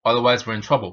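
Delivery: ordinary talk, nothing marked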